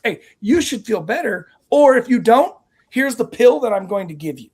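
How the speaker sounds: 의사 흉내; mock doctor. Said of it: "'cause it's like".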